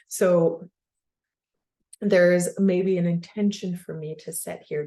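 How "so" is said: usual speaking voice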